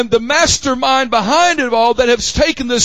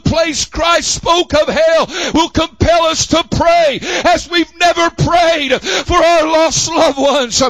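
higher-pitched, driving pulpit cadence; full-force preaching; 4 semitones higher than usual. Real, not acted. Preacher urging the church to pray for the lost, fervent. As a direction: drive each phrase home with fervor.

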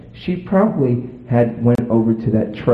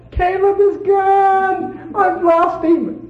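slow, high wailing imitation; mock bawling; much slower than usual. Wife telling husband the baby is gone, slow high wail. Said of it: "bawling and screaming".

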